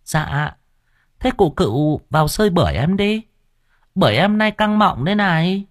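high, coy, teasing seller's voice; flirty market woman. A narrator's reading. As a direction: lay on the flirting, pitched high. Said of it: "đưa ánh mắt lả lơi mời mọc".

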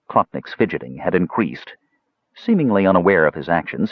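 ordinary delivery

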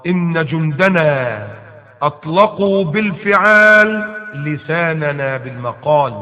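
slow, measured recital of the verse; slow recitation. Shown as a demonstration. Preacher reciting the verse to his listeners, slow and measured. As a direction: weigh each word as you recite it.

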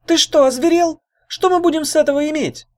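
very high, loud squeal; piercing high-pitched protest; indignant squeak. A narrator's reading. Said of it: "пискнула Брут Нежирс".

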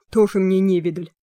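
пренебрежительное фырканье клерка; фыркни, отмахиваясь; фыркая. Acted. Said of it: "фыркнул человечек". (с пренебрежительным фырканьем)

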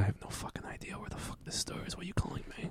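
muttered whisper under breath. Pretend. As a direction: whisper the swearing aside. Muttered rant as store clerk.

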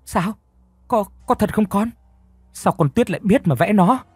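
high, stammering disbelief; mother can't believe the girl drew it; stunned disbelief. A narrator's reading. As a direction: voice jumps up in shock.

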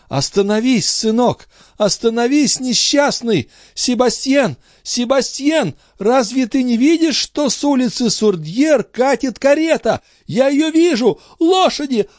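very high panicked calls, rising; mother warning her son of the carriage, very high and panicked; terrified cry. Acted. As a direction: scream a warning to the boy in panic. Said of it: "Андре жутко вскрикнула".

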